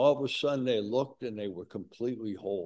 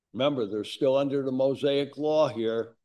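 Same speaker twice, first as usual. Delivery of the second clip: ordinary delivery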